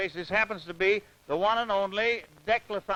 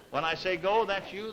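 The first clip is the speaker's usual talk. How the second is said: ordinary talk, nothing marked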